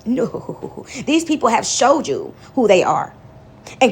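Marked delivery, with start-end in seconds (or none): 0.0-0.8 a soft firm no; whispered no. Real, not acted. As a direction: breathe a flat refusal. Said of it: "craving past".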